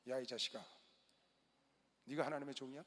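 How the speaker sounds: 낮고 조용하게 억누른 꾸중; 화를 참으며 낮게 "네가" 하고 따지듯; 낮게 꾸짖기. Retold or imitated. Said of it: "내가 막 욕은 안 했지만". (억누른 분노로 낮게 꾸짖는 목사)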